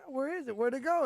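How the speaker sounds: normal talking voice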